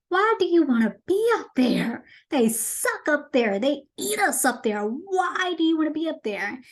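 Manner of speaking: high-pitched, exasperated pleading; exasperated questioning. Retold or imitated. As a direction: act out a baffled friend protesting, pitch swooping up. Acting Sebastian pleading with Ariel.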